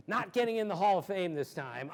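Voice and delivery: usual speaking voice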